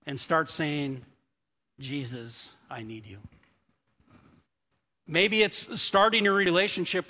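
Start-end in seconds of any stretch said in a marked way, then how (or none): 1.8-3.2 soft, low admission of need; quiet plea. Acted. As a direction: drop to a hushed, humble appeal. Quoting a surrendering plea to Jesus.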